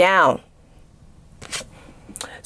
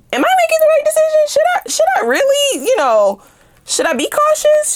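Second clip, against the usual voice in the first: much higher, fast, squeaky worried voice; mock anxious questioning. Tarot reader imitates a doubting viewer with a high, fast, anxious voice.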